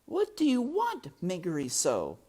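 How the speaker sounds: high, loud shouted question; shouting. A narrator's reading. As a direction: shout it so she hears. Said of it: "the princess shouted".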